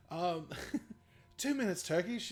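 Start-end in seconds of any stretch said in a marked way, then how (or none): 1.4-2.3 movie character quote. Retold or imitated. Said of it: "and he's like".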